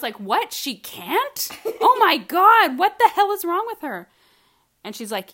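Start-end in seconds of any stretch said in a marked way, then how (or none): 0.2-4.1 much higher, shouted sarcastic alarm; mock outrage. Answering mom with hammed-up sarcastic panic. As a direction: ham up the shock over the baby.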